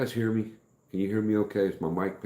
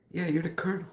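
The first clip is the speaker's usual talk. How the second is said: much higher, eager fan insisting; excited fan; 14 semitones higher, faster than usual. Retold or imitated. Retelling the fan's insistence, high and eager. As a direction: play the starstruck stranger. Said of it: "They're like".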